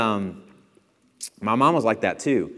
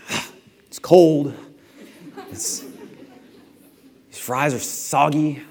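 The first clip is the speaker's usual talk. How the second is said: slow, drawn-out whine; a mock pouting gripe; hammed-up whine about cold food; whiny complaint; 3 semitones higher, 3 dB louder, slower than usual. Mocking a complaining diner.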